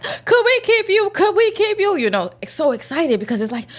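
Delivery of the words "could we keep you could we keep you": pleading excitement. Retold or imitated. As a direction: beg to keep her like a new puppy. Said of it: "They're like".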